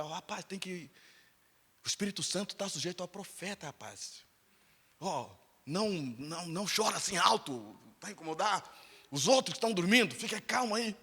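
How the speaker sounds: quietly urging him to calm down; hushed monitor calming a crying student at night; hushed warning. Retold or imitated. Hushing a student near sleepers.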